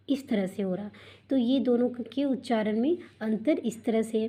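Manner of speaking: usual speaking voice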